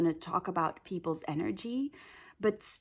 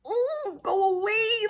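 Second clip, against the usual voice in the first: much higher, mock sneer; sneering brush-off; 12 semitones higher, 6 dB louder, slower than usual. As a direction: squeak a sneering go away. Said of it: "his energy was just like".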